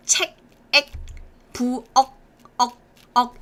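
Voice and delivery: "책, 액" and "억" each end on a k closed off in the throat; unreleased final k. Shown as a demonstration. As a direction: clip every syllable with the tongue shutting the throat. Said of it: "same final sound as this one".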